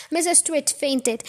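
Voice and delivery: usual speaking voice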